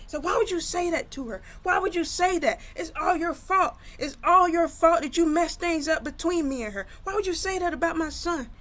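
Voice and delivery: high, loud accusing outburst; angry confrontation. Retold or imitated. Brother pins his sister to the wall, angry accusing. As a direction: pitch it up and lay into her with the blame.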